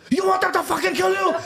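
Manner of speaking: very high-pitched strained yelling; imitated furious shout. Dad who grabbed his son, high furious mock shout. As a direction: scream it as an enraged father.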